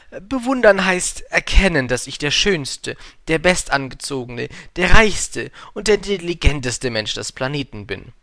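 high, loud self-admiring boast; vain man. A narrator's reading. Vain man defines admiration for the prince, loud and boastful. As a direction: proclaim each superlative grandly.

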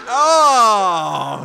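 sudden loud yelp of alarm; startled shout. Real, not acted. Gamer caught by a customer, startled shout. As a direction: jolt out a loud oh. Customer reaches him in the game.

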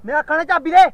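loud, furious demand for the keys; fake angry yell. Prankster shouting at friend for keys, fake furious. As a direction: yell it like you're ready to fight.

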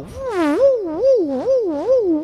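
high wailing woo siren imitation; siren noise. Preacher imitates the highway patrol siren, high wailing woos. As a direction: mimic a patrol car siren.

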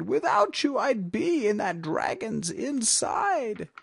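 high, relieved witch voice; grateful cry. A narrator's reading. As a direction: gush with gratitude at being saved. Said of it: "the grateful witch cried".

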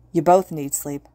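ordinary delivery

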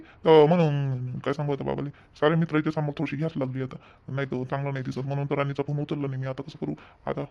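low, very soft muttering; private aside. Acted. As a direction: think aloud so the parents won't hear. Son's worried aside to himself.

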